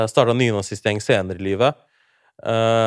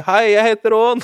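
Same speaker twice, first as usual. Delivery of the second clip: higher, raised call to a barking dog; retold calling out; 10 semitones higher, 5 dB louder than usual. Boy retelling how he called out to a barking dog, raised mock call. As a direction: shout a friendly hello over the barking. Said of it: "jeg prøver å rope til den her".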